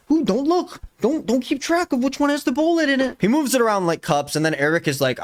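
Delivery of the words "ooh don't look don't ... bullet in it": high-pitched mocking squeal of a quote; mock coy. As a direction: put on a high, silly voice to mock him. Mocking the gun-shuffling trick.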